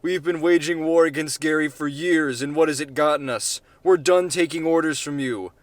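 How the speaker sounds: a delegate yelling defiance at the president. Acted. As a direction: shout it at the president. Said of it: "Another voice yelled".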